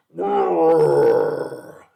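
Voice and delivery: huge, long, loud roar; monster roar. A narrator's reading. Monster rearing up before a boy in forest, roaring. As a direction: let out a looming beast's roar. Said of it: "it roared".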